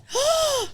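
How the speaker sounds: sharp high gasp; shocked gasp. Real, not acted. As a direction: gasp in mock horror.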